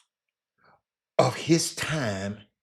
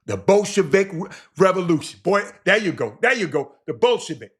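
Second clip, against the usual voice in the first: excited outburst; 7 semitones higher, 8 dB louder than usual. Real, not acted. Excited aside about the revolution.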